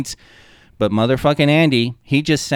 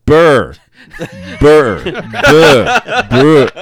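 mock stammering burr; 5 semitones higher, 10 dB louder, much slower than usual. Imitating stuck clue-giving.

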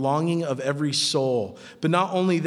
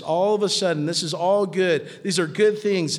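normal talking voice

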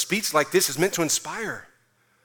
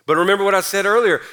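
ordinary talk

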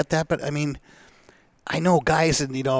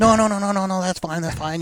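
imitating a salesman's rapid, high-pitched no-no-no; hasty backpedalling; 8 semitones higher than usual. Salesman hastily backs off.

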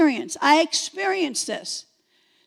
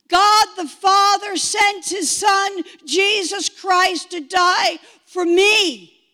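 forceful proclamation; 3 dB louder, slower than usual. Real, not acted. Testifier declaring what she suddenly knew, forceful proclamation. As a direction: proclaim it word by weighty word.

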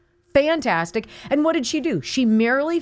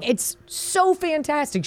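ordinary delivery

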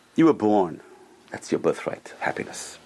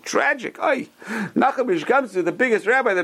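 lament; 10 semitones higher than usual. Acted. Lament over rabbi's fate.

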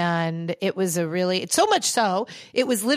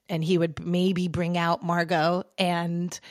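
usual speaking voice

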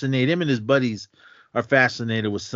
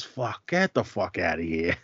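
put-on Italian-American accent; 6 dB quieter than usual. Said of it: "every word was".

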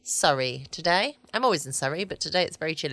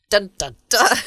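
louder mock suspense sting; dramatic dun dun dun; 3 semitones higher, 8 dB louder than usual. Host announcing the episode title with a mock dramatic sting. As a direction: belt out a hammed-up thriller sting. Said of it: "the noise, dun, dun, dun".

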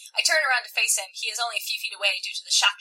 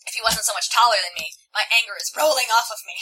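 plain reading voice

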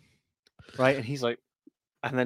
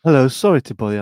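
louder polite pitch, imitated; mock beggar spiel; 9 dB louder, faster than usual. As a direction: put on the homeless guy's patter. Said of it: "same spiel".

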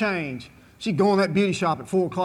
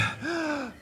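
sudden high gasp for air; first gasp after being winded; sharp gasp; 9 semitones higher, 9 dB quieter than usual. Retold or imitated. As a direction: suck in a breath at last. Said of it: "And I went".

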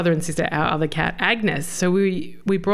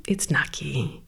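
put-on hushed whisper to the toddler; 6 semitones lower, 6 dB quieter, slower than usual. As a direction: breathe the name so the child settles. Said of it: "And then we're like".

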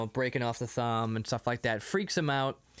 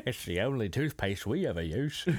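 low, put-on British accent; Michael Caine; 5 semitones lower than usual. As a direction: do a hammy British film-star accent.

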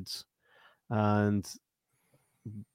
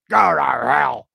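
a lightly voiced, louder zombie growl; zombie snarl; 2 semitones higher, 6 dB louder, slower than usual. Retelling the first film, the zombie's curse as a growl. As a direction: spit it out like a risen corpse. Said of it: "he's like".

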